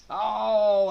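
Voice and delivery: high loud burst of surprise; sudden gasp shout. Real, not acted. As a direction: jump at the big move. Sudden move in the match.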